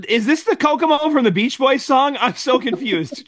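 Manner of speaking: high, laughing disbelief; amused surprise. Real, not acted. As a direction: break in, tickled and puzzled. Amused interruption about Beach Boys.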